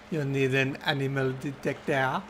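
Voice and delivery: put-on crappy French accent. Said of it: "French accent makes these words".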